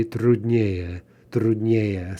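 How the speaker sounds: "труднее" said as trud-NYE-ye, stress on the first е of -ее; stress on the ending. Shown as a demonstration. Teacher points out the ending, stressing не in "труднее". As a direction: lean on не in "труднее". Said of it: "stress on the ending".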